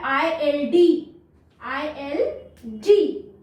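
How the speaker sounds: ILD drawn out slowly; slow letter naming; slow spelled letters. Shown as a demonstration. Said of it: "modelling letters".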